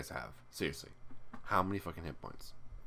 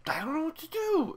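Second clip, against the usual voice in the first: high whiny despair; 4 dB louder than usual. Acted. Exasperated whine.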